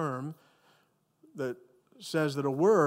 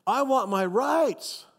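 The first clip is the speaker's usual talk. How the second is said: high, slow demanding whine; mock the entitled, stretching I want; hammed-up whiny demand; 8 semitones higher, 4 dB louder, much slower than usual. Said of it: "Have you heard that in our culture recently".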